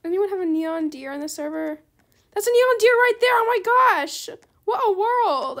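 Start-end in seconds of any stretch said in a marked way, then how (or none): 2.3-5.6 loud, high-pitched excited outburst; surprised. Real, not acted. Player spots the Neon Deer they wanted, thrilled surprise. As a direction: exclaim it loud and high, amazed. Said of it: "I didn't expect that".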